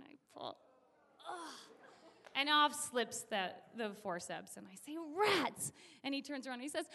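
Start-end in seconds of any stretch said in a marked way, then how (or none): 1.3-1.5 acted effort groan; mimed straining "ugh"; straining grunt. Yanking and pulling. 5.2-5.7 mild frustration. Acted. Forceps slip off.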